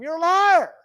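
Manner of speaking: loud, high shout at the TV; mock yell at the TV. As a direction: yell it at the news anchor. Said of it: "you just start yelling at 'em".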